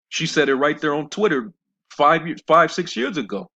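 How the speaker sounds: loud, high-pitched exclamation; emphatic exclaiming. Real, not acted. Preacher calling out a wife's old tweet, emphatic exclaiming. Exclaiming over her contradiction.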